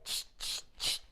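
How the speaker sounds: unvoiced ch ch ch; monkey chatter. A narrator's reading. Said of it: "monkeys ... said".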